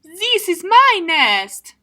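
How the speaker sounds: high, loud duck claiming the nest; bossy duck. Acted. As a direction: stake the claim like a stubborn duck. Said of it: "And the duck says".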